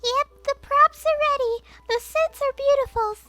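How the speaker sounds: put-on high, bright-eyed scientist girl; high character voice. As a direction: lift the voice way up for Washu. Washu answers the nervous director.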